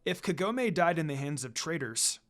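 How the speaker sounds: ordinary delivery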